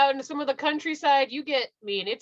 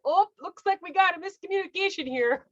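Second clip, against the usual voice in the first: Midwestern email voice; 8 semitones higher than usual. Retold or imitated. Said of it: "I was like".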